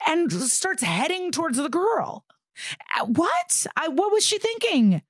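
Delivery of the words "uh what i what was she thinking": high incredulous what, pitched way up; real shock at a dumb move; disbelieving outburst. Real, not acted. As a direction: voice jumping up in disbelief. Host reacting in disbelief.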